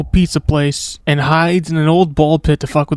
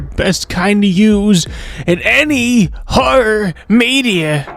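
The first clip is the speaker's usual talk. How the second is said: spooky drawn-out voice; 5 semitones higher, much slower than usual. Pretend.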